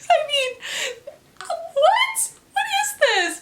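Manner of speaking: real baffled what; high incredulous squeak. Real, not acted. Disbelief at the ghost description.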